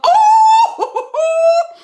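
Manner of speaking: loud, high shriek that breaks into a laugh; a loud yelp of shock turning into laughter. Real, not acted. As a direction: shriek in disbelief. Shocked by the secret mode.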